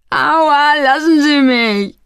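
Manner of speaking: high, drawn-out yelp; whining, high-pitched plea to be let go; pained protest. A narrator's reading. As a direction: cry out at a blow. Young man has been hit.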